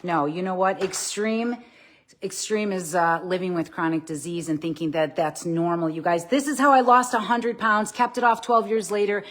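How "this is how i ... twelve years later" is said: excited. Real, not acted.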